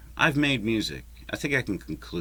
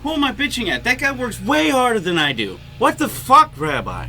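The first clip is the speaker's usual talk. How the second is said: high, loud exasperated outburst at himself; exasperated yell; 9 dB louder than usual. Real, not acted. Vlogger scolding himself after griping at the neighbour, loud and high. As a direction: throw up your hands and shout at yourself.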